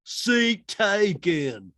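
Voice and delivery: very slow, loud, high drawl; hammed-up slow movie-character drawl; Forrest Gump impression. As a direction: draw each word right out like a simple Southerner. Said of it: "very Forrest Gump".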